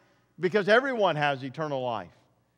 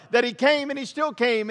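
"came" and "still came" lifted higher and louder; stress on still came; 6 semitones higher, 3 dB louder than usual. Shown as a demonstration. Preacher pressing "still came" on his congregation. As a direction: hammer "he still came" home. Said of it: "that's what I'm trying to emphasize".

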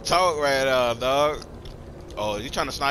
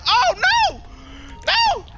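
high, louder panic cry; real alarmed shout; 3 dB louder, slower than usual. Real, not acted. Gamer yelping as enemies round the corner in panic. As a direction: yelp as enemies appear.

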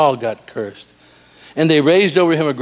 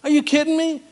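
incredulous; 12 semitones higher than usual. Real, not acted.

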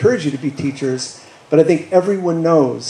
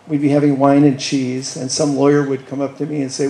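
usual speaking voice

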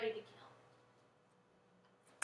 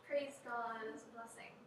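mock pious praise; 8 dB louder, slower than usual.

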